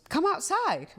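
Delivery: high-pitched cheery invitation; eager beckoning. Retold or imitated. Husband calling wife out to see something, eager. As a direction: coax with sudden perkiness, voice way up.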